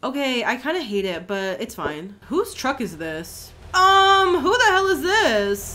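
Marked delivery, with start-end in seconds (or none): 3.7-5.7 high, loud who the hell; startled, offended question; sharp indignant um; indignant surprise. Real, not acted. Indignant at stranger's truck.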